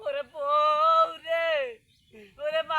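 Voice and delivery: loud, very slow wail; hammed-up bereaved keening; theatrical crying. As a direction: howl with long held vowels. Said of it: "তুই কান্দিস কে".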